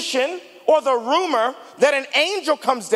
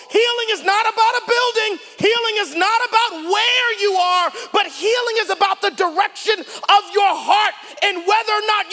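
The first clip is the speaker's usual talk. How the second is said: full-force preaching; 6 semitones higher than usual. Acted. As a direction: preach it out to the room, rising.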